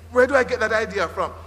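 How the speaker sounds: usual speaking voice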